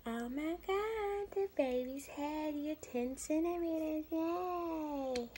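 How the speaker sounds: high, loud cheery announcement; mock-excited nurse, slow start. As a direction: cheer the good news.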